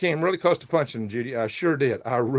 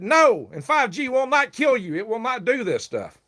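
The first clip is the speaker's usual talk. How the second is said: retold retort; 10 semitones higher than usual. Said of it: "I said".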